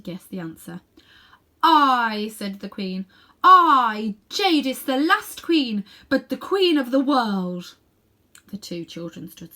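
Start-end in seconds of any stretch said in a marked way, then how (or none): 1.6-2.3 a loud, ringing I; a queen's loud boast of herself; proud proclamation. Acted. 3.4-7.8 loud, high and slow, each title rung out; queenly proclamation. Acted. Queen proclaims her name.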